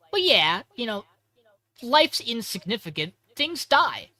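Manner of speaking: high, loud, breezy about death; airy creepy Hikaru. Retold or imitated. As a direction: shrug off death lightly, pitched high.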